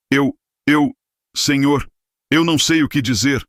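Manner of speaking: stammer, on the edge of crying; near tears. A narrator's reading. Evandro facing the raging host, panicked near tears. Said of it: "Evandro estava prestes a chorar".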